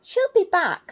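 high, loud hopeful owl; Sarah the owlet. Acted. Sarah reassures her brothers, high and loud. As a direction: reassure the others as the big sister. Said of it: "said Sarah".

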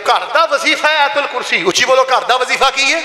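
high-pitched call to the congregation; rally the crowd with rising fervour; rousing preaching. Acted. Preacher urging worshippers to recite against Satan, rousing.

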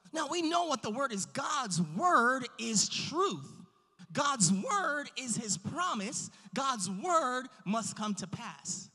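forceful pulpit encouragement; emphatic preaching. Real, not acted. Preacher encouraging the congregation about a limitless God, emphatic preaching. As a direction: preach it with drawn-out key words and big pauses.

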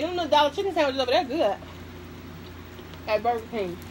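ordinary talk

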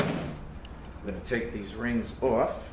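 usual speaking voice

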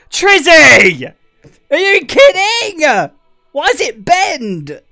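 loud, high, stunned yelling; a real shocked shout at a pic; shocked outcry. Real, not acted. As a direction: blurt it out in real disbelief. Shocked by a picture on stream.

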